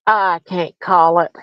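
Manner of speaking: normal talking voice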